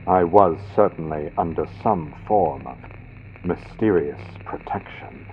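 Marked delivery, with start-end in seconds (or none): none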